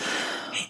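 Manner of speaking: a long, weary breath out; exasperated sigh. Real, not acted. Fed up with the agencies, a weary sigh. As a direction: sigh like you've had it with all of them. Exasperated at being turned away.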